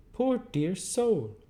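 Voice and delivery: high, slow, prim lady's voice; high and slow mock-genteel sigh; abrupt Camilla. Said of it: "with an abruptness of manner quite my sister's".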